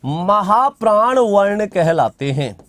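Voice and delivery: slow, measured dictation with long pauses. Acted. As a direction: spell the definition out for students to write down. Dictating a definition. (धीमे-धीमे लिखवाते हुए)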